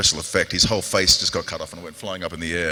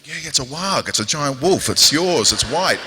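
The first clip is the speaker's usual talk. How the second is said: impatient director; 6 semitones higher, 4 dB louder than usual. Retold or imitated. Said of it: "He's going".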